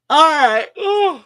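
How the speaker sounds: words stretched out through a big yawn. Real, not acted. Host yawning on camera, tired from early mornings, mid-yawn. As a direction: let the words ride up on a yawn. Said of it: "Sorry about yawning".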